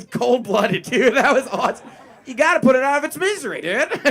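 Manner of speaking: loud, fast, laughing shout of approval; mock macho shout. Comic to the audience about the deer, laughing mock shout. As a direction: hype it up like a bro cheering.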